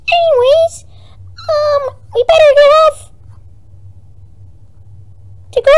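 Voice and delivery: very high squeaky mushroom guy; tiny excited cartoon helper voice; Toad voice. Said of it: "Toad is more important".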